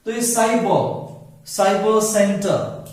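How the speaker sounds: normal talking voice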